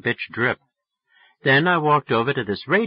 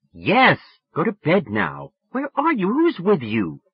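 higher, lightly voiced exasperated girl; exasperated scolding; 9 semitones higher than usual. A narrator's reading. Sally to drunk friend late at night, exasperated. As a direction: humour him, then question him sharply.

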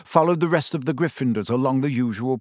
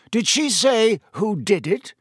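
ordinary delivery, nothing marked

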